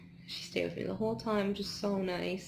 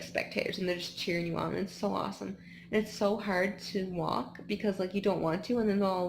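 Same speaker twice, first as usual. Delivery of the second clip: ordinary talk, nothing marked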